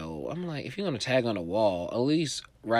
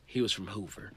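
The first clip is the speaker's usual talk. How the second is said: ordinary talk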